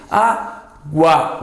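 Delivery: "Água" said with U and A clearly sounded; spelled-out demo word. Shown as a demonstration. Teacher models "Água" for students. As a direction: sound out "Água" slowly for the class. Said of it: "O U é pronunciado".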